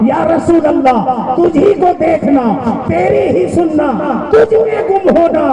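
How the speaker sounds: rising, high-pitched devotional climax; fervent preaching. Acted. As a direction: build to an ecstatic cry, phrase by phrase. Rapturous climax of sermon.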